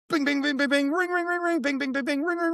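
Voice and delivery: high-pitched mimicked phone dings and rings, rattled off; imitated phone pings. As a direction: chirp out a flurry of phone alerts in a high voice. Phones blowing up at once.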